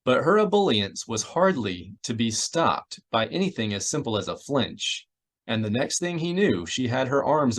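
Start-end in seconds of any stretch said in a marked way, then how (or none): none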